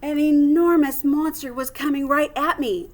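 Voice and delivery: plain reading voice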